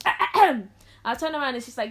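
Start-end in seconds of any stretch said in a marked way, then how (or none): 0.1-0.7 high, loud "ahem"; throat-clearing. Retold or imitated. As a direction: clear your throat pointedly for attention. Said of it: "she goes".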